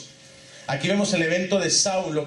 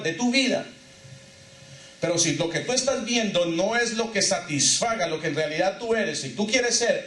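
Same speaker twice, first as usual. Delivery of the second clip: impassioned preaching; 5 semitones higher than usual. Acted. Rising fervour of the preaching.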